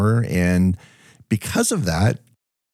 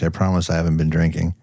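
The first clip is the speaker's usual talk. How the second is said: ordinary talk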